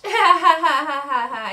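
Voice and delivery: loud, high jeering laugh; mocking laugh. Aurelia laughs at the centaur, loud and jeering. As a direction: cackle at the centaur.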